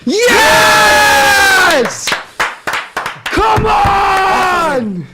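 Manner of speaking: joyful high shout; celebrating. Real, not acted. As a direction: yell it out in triumph. Full-time win celebration.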